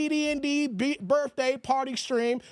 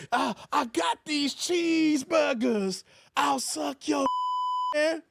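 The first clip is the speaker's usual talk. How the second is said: movie character quote; 3 semitones higher, slower than usual. Retold or imitated. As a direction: ham it up like the comedy scene. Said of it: "Remember Don't Be Mad South Central?".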